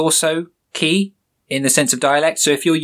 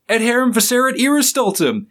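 much higher, put-on cartoon voice reciting Latin; Mordecai speaking Latin; 10 semitones higher than usual. Host voicing Mordecai's Latin reply, much higher cartoon voice. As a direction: pitch it up like a cartoon bird declaiming. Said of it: "And then Mordecai replies".

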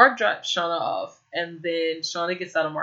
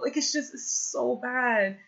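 exasperated; 6 semitones higher than usual. Real, not acted. Exasperated rant about the show.

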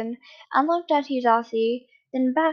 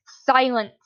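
short shout, a little louder; shouted command; 5 dB louder than usual. A narrator's reading. Man cutting off the pleading captive, shouted. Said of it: "the man shouted".

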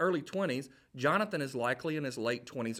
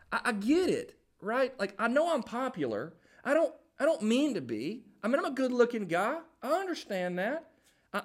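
high-pitched, hammed-up injured innocence; bewildered protest; 7 semitones higher than usual. David pleading innocence to his friend Jonathan, bewildered protest. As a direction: plead your case, climbing to a squeak.

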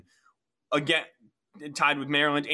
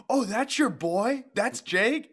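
surprised friends; 9 semitones higher, slower than usual. Retold or imitated. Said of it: "they gotta kick him in there".

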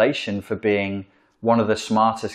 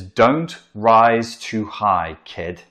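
very slow, louder, drawn-out warning; 4 dB louder, much slower than usual. Retold or imitated. Parent warning the child, slow and louder. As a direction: lay out each word as a stern parental warning. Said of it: "another injunction".

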